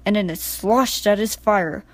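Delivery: plain reading voice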